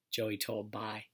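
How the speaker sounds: ordinary delivery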